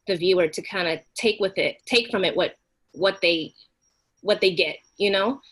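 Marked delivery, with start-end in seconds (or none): none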